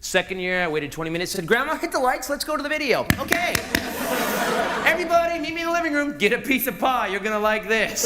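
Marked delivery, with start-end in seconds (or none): 1.5-8.0 a high, upbeat host voice; showman host. Retold or imitated. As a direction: introduce the family video like a showman. Son hosting video showing.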